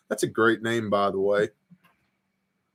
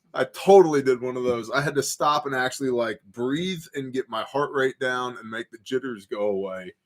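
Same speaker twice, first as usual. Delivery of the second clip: normal talking voice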